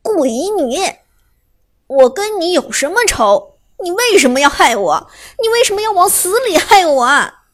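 pained, rising to a desperate wail; anguished outcry. Acted. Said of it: "痛苦地说".

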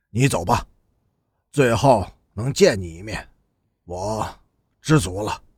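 low, slowing, spent farewell; understated tearful resignation; hoarse from crying. A narrator's reading. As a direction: let it sink low and drag at the end. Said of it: "边雅君的嗓子哭哑了，".

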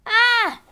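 sudden high yelp of pain; sharp loud pained cry. Acted. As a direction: shriek as the teeth sink in. Bitten hand, cries out.

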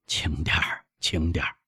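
put-on softly amused old father; gentle, smiling. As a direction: hush your excited son softly. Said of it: "老人微笑着说".

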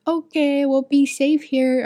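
imitating small kids answering sweetly; little kid voice. Daycare kids answering their teacher, high childlike voice.

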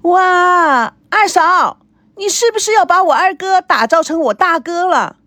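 high excited call; young sister's high excited call. Acted. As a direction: shout it across the room. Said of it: "她大叫起来".

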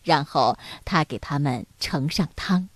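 ordinary delivery

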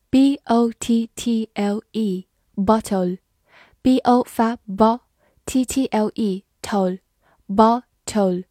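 normal talking voice

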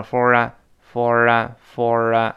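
"for啊" slowly, r gliding into the vowel; drawn-out demo of linked "for啊"; linked pronunciation. Shown as a demonstration. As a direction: run "for" into "a" as one word, "for啊". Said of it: "for跟a连读变成for啊".

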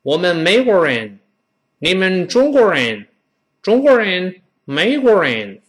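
put-on American-accented Chinese, English r in 人; foreigner's accented Mandarin. Foreign teacher speaking Chinese in Beijing, American-accented Mandarin. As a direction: imitate an American teacher's awkward Mandarin tones. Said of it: "有的时候说中国话我们觉得别扭".

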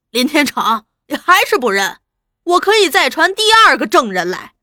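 shouted demand. Acted. Interrogator threatens a second witness, shouting. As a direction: press the prisoner at full voice. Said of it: "朱大同又喝道".

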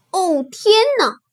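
high-pitched startled exclamation; alarmed cry. Acted. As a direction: cry out in storybook panic. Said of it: "它叫道". (惊慌地叫喊)

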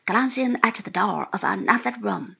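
ordinary talk

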